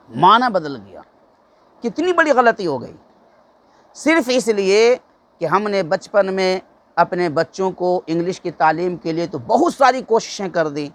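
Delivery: normal talking voice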